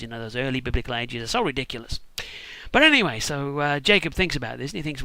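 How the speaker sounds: ordinary talk, nothing marked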